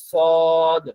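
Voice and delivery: usual speaking voice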